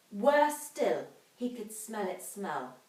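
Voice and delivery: plain reading voice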